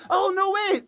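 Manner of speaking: high, hammed-up gasp of recognition; mock surprise. Pretending to recognise a name she gives, hammed-up high surprise. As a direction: fake being stunned by a name. Retelling own fake recognition reaction.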